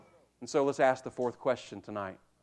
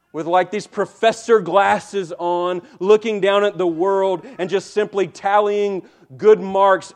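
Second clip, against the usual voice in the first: louder, driving sermon voice; full-force preaching; 6 semitones higher, 9 dB louder than usual. Acted. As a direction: preach it hard to the congregation.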